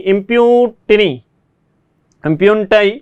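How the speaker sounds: "impunity" stretched out, every syllable slow and clear; slow, drawn out. Shown as a demonstration. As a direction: say "impunity" slowly, as if for dictation. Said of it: "teacher repeats the word slowly". ("impunity" को धीरे-धीरे, हर अक्षर खींचकर बोलिए)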